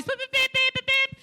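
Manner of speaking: high, steady electronic beeps; device beeping. Retold or imitated. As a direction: imitate a little tracker beeping, high and even. Imitating the tracking device.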